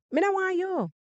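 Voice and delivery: high Jamaican patois "me no want you", said as the woman refusing him. Shown as a demonstration. Woman turning the man down, in Jamaican patois. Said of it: "my title half Jamaican".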